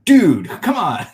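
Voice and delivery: high, slow surfer dude. Pretend. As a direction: stretch it out like a surfer.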